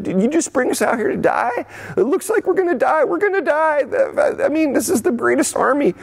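high-pitched panicky whine; mock whining. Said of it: "they start whining. They start complaining".

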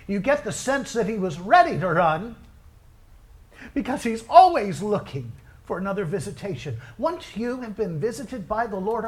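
high, impassioned preacher delivery; fervent preaching. Real, not acted. Pastor stirring the congregation to long for God, fervent preaching. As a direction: preach it high with punched key words.

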